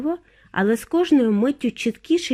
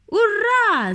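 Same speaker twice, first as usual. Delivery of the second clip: high, jubilant hooray; joyful high cry; overjoyed cheer; 12 semitones higher, 4 dB louder than usual. A narrator's reading. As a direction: cheer in delight. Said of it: "зрадів містер Вонка".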